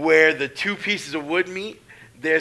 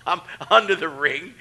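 ordinary talk, nothing marked

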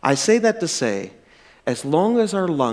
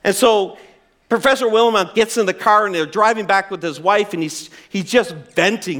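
usual speaking voice

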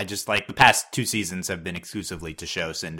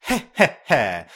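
smug villain chuckle; 3 semitones higher, 3 dB louder, slower than usual. Retold or imitated. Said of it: "she sounds the same".

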